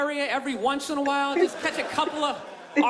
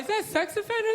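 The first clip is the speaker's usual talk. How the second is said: higher, mock-alarmed parent asking; suspicious parent; 7 semitones higher than usual. Parents eyeing a friendly stranger, mock alarm. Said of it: "Now parents are like".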